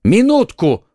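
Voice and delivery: loud, high urgent call; exclaiming. A narrator's reading. D'Artagnan halts his friends drawing swords, exclaiming. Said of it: "воскликнул Д'Артаньян".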